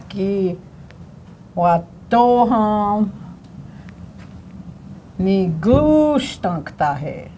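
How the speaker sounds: each syllable said slowly with the stress marked; syllable stress demo. Shown as a demonstration. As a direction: teach the stress word by word. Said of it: "See where the stress marks are?".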